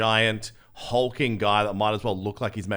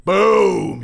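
loud boom; 11 semitones higher, 12 dB louder than usual. Acted. Punchline of bouncer story.

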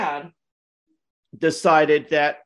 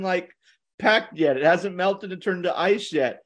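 usual speaking voice

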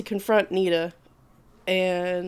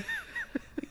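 burst of laughter; 15 dB quieter than usual. Real, not acted. Laughing at the fight.

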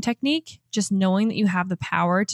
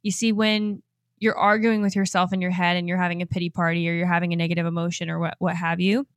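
ordinary delivery

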